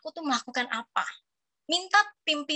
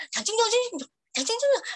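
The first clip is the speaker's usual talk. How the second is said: mock Mandarin babble, higher; 9 semitones higher than usual. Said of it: "Terus makin didengar".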